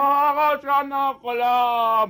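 very slow, loud, stretched cry; drawn-out shout. Acted. Injured biker answers the driver, slow mock lament.

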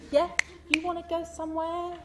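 put-on high, laughing cockney girl voice; cheeky London girl with a laugh; EastEnders accent. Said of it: "EastEnders meets, meets Islam".

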